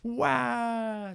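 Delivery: high, loud mock wail; child's wail. Jamila wailing at having her hair combed, hammed-up cry. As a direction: let out a little girl's cry. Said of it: "Jamila cries".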